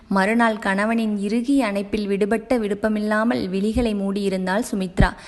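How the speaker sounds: ordinary delivery, nothing marked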